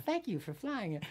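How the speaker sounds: high-pitched airline farewell; stewardess send-up. Retold or imitated. Stewardess at the plane door, sketch-show send-up. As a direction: imitate a sketch-show flight attendant. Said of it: "you know, that Saturday Night Live".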